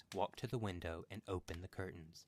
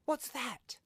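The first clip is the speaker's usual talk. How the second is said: very high, loud startled cry; 6 dB louder than usual. A narrator's reading. Wife hears knock, cries out to husband. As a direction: cry out in fright. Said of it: "cried the old woman".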